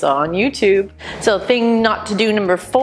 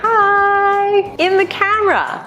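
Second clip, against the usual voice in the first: cheery high greeting; 12 semitones higher, slower than usual. Shown as a demonstration. Demonstrating talking into camera.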